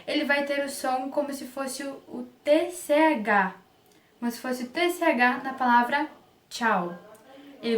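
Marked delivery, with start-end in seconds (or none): none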